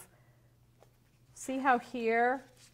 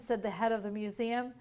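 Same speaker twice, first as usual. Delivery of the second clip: plain reading voice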